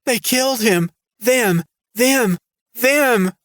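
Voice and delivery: very high, rising panicked shrieks; screaming in terror. A narrator's reading. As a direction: point and scream at the pursuers. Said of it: "pointing and screaming".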